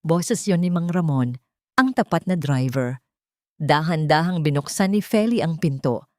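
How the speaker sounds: usual speaking voice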